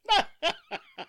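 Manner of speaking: high scornful cackle; mocking laugh.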